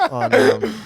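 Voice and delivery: high giggling break; laughing. Real, not acted. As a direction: crack up on the words. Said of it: "laughing mid-sentence".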